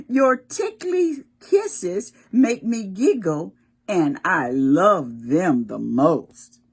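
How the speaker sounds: put-on loud, slow read-aloud to children; storybook reading. Teacher reading the book's tender lines to children, loud and slow. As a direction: savour each word like a bedtime picture book. Reads book text aloud.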